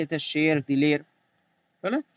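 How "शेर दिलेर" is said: e vowel in "शेर, दिलेर", not ee; "दिलेर" ending in an e sound before r; ya majhool. Shown as a demonstration. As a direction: say "शेर" with an open e, not a stretched ee. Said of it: "खींच कर ना पढ़ी जाए".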